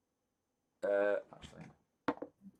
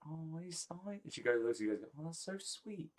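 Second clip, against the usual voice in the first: sweet princess; 4 semitones higher than usual. Acted. GM voices Grace, touched.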